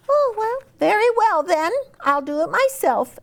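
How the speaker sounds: very high, clucky hen voice. Acted. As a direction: squeak it out like a busy little hen.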